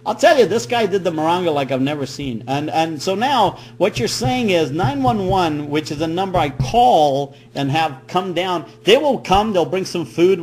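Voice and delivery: ordinary talk, nothing marked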